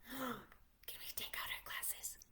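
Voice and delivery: hushed eager whisper; excited whisper. Acted. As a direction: gasp then soft whisper.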